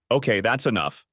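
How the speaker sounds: ordinary delivery, nothing marked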